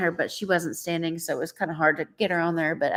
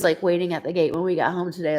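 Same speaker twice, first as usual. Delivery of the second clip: normal talking voice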